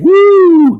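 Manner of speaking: high, loud cheering "woo"; excited whoop. Real, not acted. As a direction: whoop loudly for arriving friends. Cheering arriving viewers.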